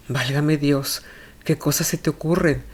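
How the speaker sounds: usual speaking voice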